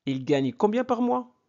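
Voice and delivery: pitched high on "combien par mois"; questioning intonation. Shown as a demonstration. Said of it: "Avec intonation".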